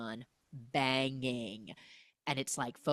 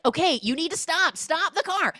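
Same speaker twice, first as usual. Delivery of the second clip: high, loud mock panic; 12 semitones higher, 5 dB louder than usual. Dad in the speeding car, loud mock panic. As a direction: urge him to stop, alarmed.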